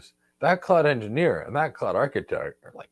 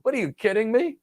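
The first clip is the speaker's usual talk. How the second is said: incredulous architect; 9 semitones higher than usual. Retold or imitated. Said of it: "They're like".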